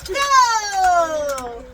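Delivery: loud, long-drawn go; race start call. Real, not acted. Mother starting the toy car race, calling out. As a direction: launch the race with a stretched call.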